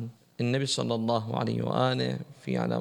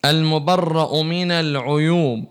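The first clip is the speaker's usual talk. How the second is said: fervent preaching; 3 semitones higher, 7 dB louder, slower than usual. Acted. Preaching at full force.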